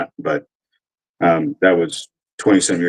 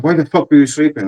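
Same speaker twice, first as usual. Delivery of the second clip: higher-pitched mock accusation; imitated irate questioning, pitched up; retold angry demand; 7 semitones higher than usual. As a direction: act out a sergeant's indignant challenge. Acts out sergeant's angry accusation.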